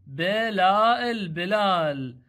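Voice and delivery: بلال drawn out very slowly, the long لا held; slow teacher's reading of بلال; stretched out slowly. Shown as a demonstration. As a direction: stretch the name for the learners. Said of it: "إذا بدون حركات".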